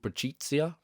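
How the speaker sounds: attempted Italian pronunciation of the name; a light, tentative Italian accent. Shown as a demonstration. Reviewer trying an Italian name with flair, light Italian accent. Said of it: "a bit of Italian accent on there".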